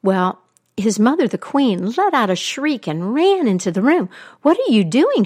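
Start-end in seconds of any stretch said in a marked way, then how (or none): 4.4-5.2 high alarmed cry. Acted. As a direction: shriek it in horror. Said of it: "she cried".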